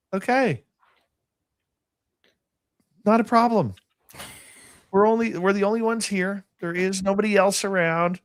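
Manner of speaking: high reenacted self-reassurance; high-pitched self-quote. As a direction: quote yourself in a high, held-up voice. Retelling his reaction to the voice.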